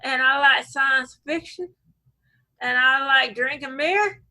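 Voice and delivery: drawled mock Alabama introduction; acquired Alabama accent. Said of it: "within a year I was like".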